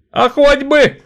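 high, loud defiant retort; cocky lad. A narrator's reading. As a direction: snap up like a sparrow. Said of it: "задиристым воробушком". (задиристо)

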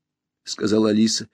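ordinary delivery

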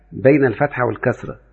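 ordinary talk

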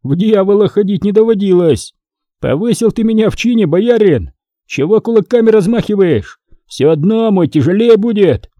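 high-pitched, defiant brawler; cocky challenge, pitched well up; boastful Foma. A narrator's reading.

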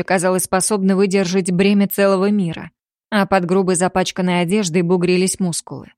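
ordinary delivery, nothing marked

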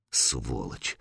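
low, hushed curse to oneself; inner thought. A narrator's reading. Sanyok silently cursing the warrior, inner thought. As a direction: keep the insult under your breath. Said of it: "подумал Санёк".

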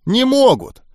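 high-pitched exasperated denial; emphatic contradiction. A narrator's reading. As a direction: shut the argument down, pitched well up. Goblin flatly contradicts the narrator. (раздражённо отрицая, высоким голосом)

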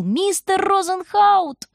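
put-on girl screams name with all her strength; with all her might. A narrator's reading. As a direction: stretch out Розенхаут as a desperate long cry. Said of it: "кричит ещё раз изо всех сил".

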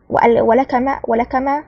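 usual speaking voice

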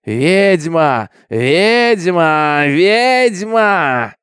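loud, long-drawn mob chant; crowd shouting. A narrator's reading. Mob in the yard accuses Minty, drawn-out shouts. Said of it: "кричала толпа во дворе".

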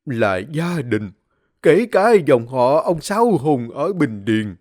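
a dying father barely getting words out; weak dying whisper. Acted. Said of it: "miệng ho sặc sụa". (giọng ông già yếu ớt thều thào)

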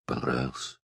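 put-on a girl's voice giving way as she admits it; hoarse, dropped voice. A narrator's reading. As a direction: let "Понравился" fall away, husky and quiet. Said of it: "У неё голос охрип, упал".

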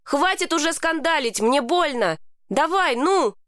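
high-pitched, pleading exclamation; narrated outcry at modest volume; mild yell. A narrator's reading. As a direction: urge them on in a pained, restrained cry. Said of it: "завопил я".

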